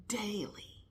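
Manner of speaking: breathy hushed "daily."; a whispered, emphatic daily. Shown as a demonstration. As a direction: drop "daily." to a bare whisper. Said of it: "When I say daily".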